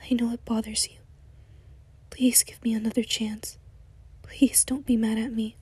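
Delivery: desperate soft whisper. Acted. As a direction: whisper the plea close to his ear. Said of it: "he whispered".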